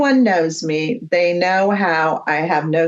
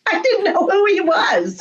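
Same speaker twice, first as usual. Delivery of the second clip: laughing through the words, much higher; 12 semitones higher than usual. Real, not acted. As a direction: giggle it out, amused at yourself. Laughing at own answer.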